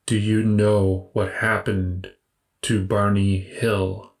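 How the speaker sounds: slow, even robotic stranger. Acted. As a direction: give orders like a machine. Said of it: "the robotic voice states".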